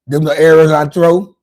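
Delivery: loud boast. Real, not acted. Boasting punchline.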